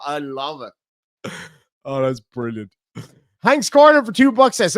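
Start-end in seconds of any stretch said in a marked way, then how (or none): 0.0-2.7 laughing. Real, not acted. Laughing at a cheeky donation.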